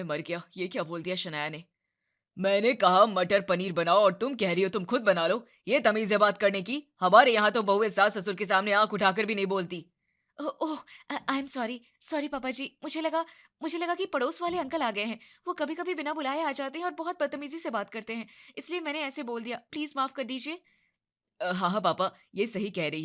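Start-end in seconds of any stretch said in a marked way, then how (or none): 2.4-9.9 louder, indignant rebuke; angry scolding. Acted. Father-in-law scolds rude daughter-in-law, loud anger. 10.4-20.6 flustered apology. Acted. Flustered apology to father-in-law.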